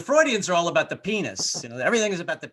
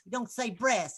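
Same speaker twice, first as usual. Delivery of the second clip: mom scolding; 8 semitones higher, 3 dB quieter than usual. Retold or imitated. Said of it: "my mom would get mad".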